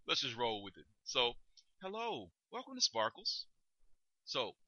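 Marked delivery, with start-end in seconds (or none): 1.8-3.5 high, lilting shop welcome; upbeat retail hello, rising at the end; rising customer-service greeting. Acted. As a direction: lift the pitch as a store greeter would. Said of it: "rises toward the end of the sentence".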